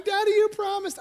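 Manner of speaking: a hint of tearful little girl; crying daughter. Daughter reproaching her father, high and mildly tearful. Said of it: "And she's crying".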